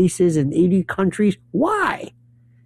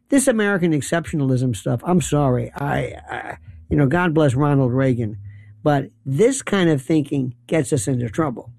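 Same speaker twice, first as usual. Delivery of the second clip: usual speaking voice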